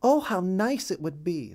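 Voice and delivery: high, hopeful mother duck voice; hopeful wife. A narrator's reading. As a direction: lift the voice, longing for home. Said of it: "said Missus Mallard".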